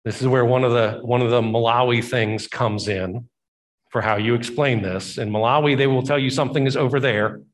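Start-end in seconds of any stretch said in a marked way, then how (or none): none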